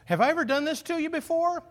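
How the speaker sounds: high, mock indignant talking-tool voice; drill talking back. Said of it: "they're ta".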